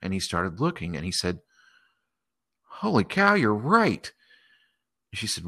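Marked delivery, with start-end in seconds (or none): 2.6-4.1 high, astonished burst on holy cow; amazed exclamation. Acted. Man peering at tiny planets, exclaiming in high amazement. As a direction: gasp it out like you can't believe your eyes.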